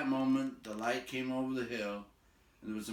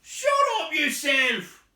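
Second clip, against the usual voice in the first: loud, high, slow troll retort; angry troll; 13 dB louder, slower than usual. A narrator's reading. Tom yelling back at William, loud. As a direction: throw it back at him. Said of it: "said Tom".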